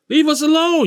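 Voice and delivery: high-pitched cry, imitating his panicked aunt; imitated yelling. Aunt pleading with the gunmen from the room, imitated yelling.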